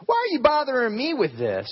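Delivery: a put-upon king complaining; frustrated king. Acted. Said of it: "He was frustrated because it was messy".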